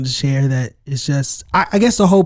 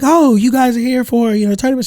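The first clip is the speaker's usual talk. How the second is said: lightly imitated lady, pitched up; chatty European lady; 7 semitones higher than usual. Lady chatting to guys in the elevator, lightly imitated. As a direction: imitate her friendly small talk in a higher voice. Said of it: "She's talking to us in her accent".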